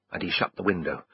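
plain reading voice

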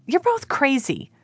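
breathless high gasp of disbelief. Acted. Callie gasping at the men in the pool. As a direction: gasp it out, caught off guard. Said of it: "She gasped out a".

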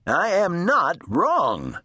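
high, mildly shouted retort. A narrator's reading. Eugenia answers her doubting sister, mild indignant shout. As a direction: insist indignantly, pitched high. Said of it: "Eugenia shouted".

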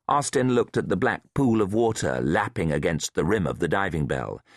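usual speaking voice

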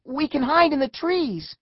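high, loud call; urgent yell. A narrator's reading. Eric to friends under attack, high urgent yell. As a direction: yell the escape plan. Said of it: "Eric yelled".

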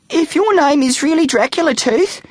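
very high, timid little voice; quivering child. A narrator's reading. Grade three kid daring to question the vampire, quivering. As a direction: squeak out a nervous challenge. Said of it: "asked a quivering grade three kid".

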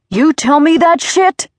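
enraged father's scream, high and loud. A narrator's reading. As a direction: scream it in outraged disbelief. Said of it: "he screamed".

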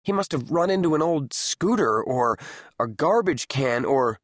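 ordinary delivery, nothing marked

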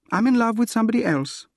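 quick, high-pitched woman's voice; acted heroine's brisk confession; Gladys, confessing. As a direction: blurt it out fast and high. Gladys confesses another love.

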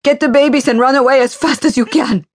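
high, loud, panicked plea to run; urgent warning. Acted. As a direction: urge the children away, frantic. Mother warns children to flee.

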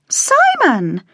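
sharp shocked cry of his name; exclaimed. A narrator's reading. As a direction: scold him in surprise. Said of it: "his sister exclaimed".

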